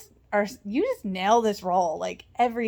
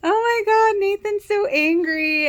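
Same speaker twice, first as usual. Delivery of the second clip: much higher, louder, thrilled; excited outburst; 12 semitones higher, 6 dB louder than usual. Real, not acted. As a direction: gush about the scene. Excited reaction to episode.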